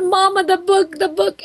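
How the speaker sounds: put-on high, tearful little boy; crying child voice. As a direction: imitate a sobbing six-year-old, pitch up. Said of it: "And he said".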